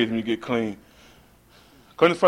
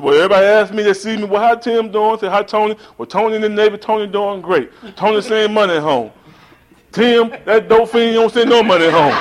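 grandma's voice; 8 semitones higher, 5 dB louder than usual. Retold or imitated. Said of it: "She said".

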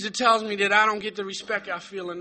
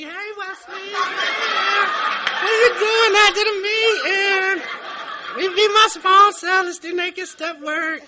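higher, laughing mock flirt; mock flirty women; 7 semitones higher, slower than usual. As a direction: tease like giggling admirers at the meeting. Mocking flirty women at meeting.

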